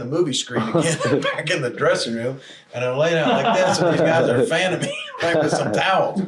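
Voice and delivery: cracking up while telling how he got knocked out; laughing. Real, not acted. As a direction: chuckle all the way through the memory.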